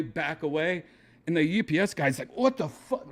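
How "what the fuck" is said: high-pitched retold yelp; mock alarmed outburst. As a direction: blurt it as if suddenly attacked. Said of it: "he thought he was getting attacked".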